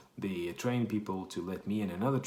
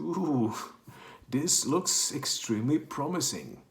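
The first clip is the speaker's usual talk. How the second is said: mock delight; 6 semitones higher, 4 dB louder, slower than usual. Pricey sandwich at Heathrow.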